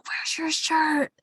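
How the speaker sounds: high, slow, stunned question; slow mock gawking. Friend voicing the best friend gawking, slow and stunned. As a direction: let the words hang, stunned. Friend gawks at shirtless hero.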